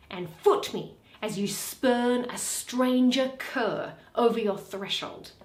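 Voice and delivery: ordinary talk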